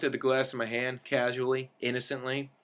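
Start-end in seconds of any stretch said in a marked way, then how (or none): none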